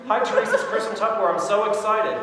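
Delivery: high, loud, enthusiastic hello; excited greeting. Shown as a demonstration. Seller shows trainee how to open the call, excited. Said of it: "Be excited".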